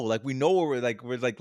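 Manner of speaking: ordinary delivery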